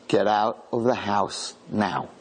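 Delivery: low, very slow command; grave warning. Retold or imitated. Rabbi ordering the soldiers out, slow and low. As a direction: drag each word out like a final warning.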